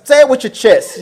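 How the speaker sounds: playful loud encouragement; loud urging. Real, not acted. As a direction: egg them on, loud and high. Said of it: "Speak up".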